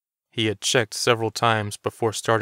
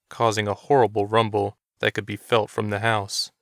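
usual speaking voice

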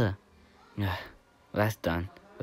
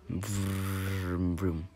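car engine noise. Pretend. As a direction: mouth vroom of a toy car. Driving toy car.

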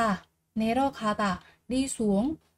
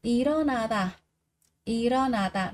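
ordinary talk

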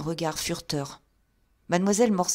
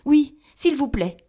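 frightened; 8 semitones higher, 8 dB louder than usual. A narrator's reading. Terrified Thaddeus agrees.

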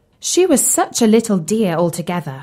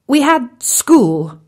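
slow and higher, searching his memory; slow, hesitant frog prince; 5 semitones higher, 4 dB louder, slower than usual. A narrator's reading. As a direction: Bertie the frog answering Tim, slow and unsure. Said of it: "he said eventually".

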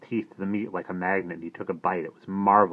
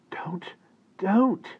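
muttered plea; 12 semitones higher than usual. A narrator's reading. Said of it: "he muttered into his ear".

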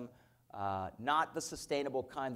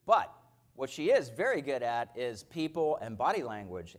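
ordinary talk

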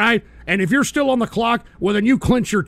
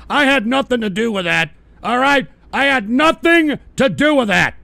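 defensive yell; 4 semitones higher, 5 dB louder than usual. Real, not acted. Denying involvement loudly.

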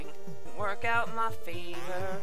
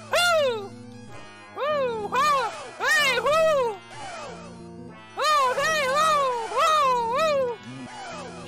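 whooping; 10 semitones higher, much slower than usual. Real, not acted. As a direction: whoop like a party.

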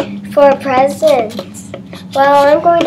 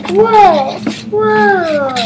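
amazed; 5 semitones higher than usual. Acted. Amazed at presents.

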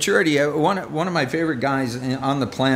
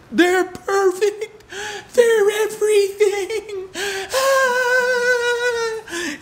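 much higher, slow, squealing with laughter and a half sob; starstruck kid; much slower than usual. Retold or imitated. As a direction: gush like a kid over an idol, almost crying. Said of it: "kids tend to idolize".

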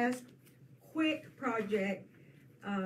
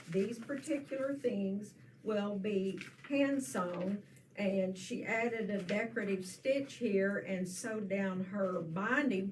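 normal talking voice

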